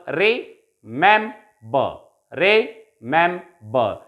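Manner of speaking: remember said very slowly, syllable by syllable; slow classroom model of remember; slow syllable drill. Shown as a demonstration. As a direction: stretch remember out so students can count it. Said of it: "recite them after me".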